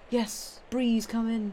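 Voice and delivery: ordinary talk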